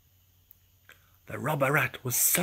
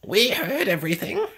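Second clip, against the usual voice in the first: higher, louder scolding; scolding duck; 6 semitones higher, 7 dB louder than usual. Acted. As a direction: tell the thief off. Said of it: "scolded Jemima".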